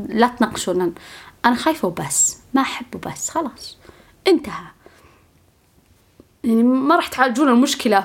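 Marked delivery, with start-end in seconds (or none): 1.5-3.8 flat whispered insistence. Acted. Curt reply to people's questions. 4.3-4.7 whisper. Acted. Ending the argument.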